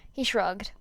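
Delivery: ordinary delivery, nothing marked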